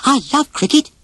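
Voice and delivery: put-on high, eager young elephant voice; Dumbo. Dumbo offers to play with the boys, high and eager. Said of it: "offered Dumbo".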